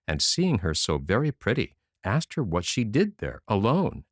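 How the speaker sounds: plain reading voice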